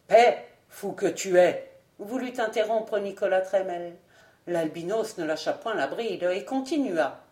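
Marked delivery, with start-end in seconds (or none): 0.1-1.7 snapping louder to silence him; sharp rebuke. Acted. Old lord cuts off the madman, sharp and louder. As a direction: cut him off hard.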